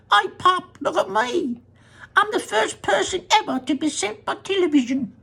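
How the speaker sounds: put-on very high, mouse-like boy's voice; a tiny boy calling from inside the TV; tiny squeaky voice. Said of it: "A tiny little voice, no louder than the squeaking of a mouse".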